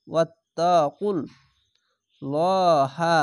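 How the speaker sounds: slow recited word; slow recitation. Shown as a demonstration. Teacher assembling the word letter by letter, then reciting slowly. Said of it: "repeats the assembled word".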